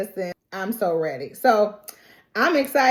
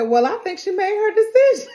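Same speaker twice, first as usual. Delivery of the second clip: much higher line broken by laughter; laughing; 12 semitones higher, 4 dB louder than usual. Real, not acted. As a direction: laugh through the quote. Commander quoted, laughing.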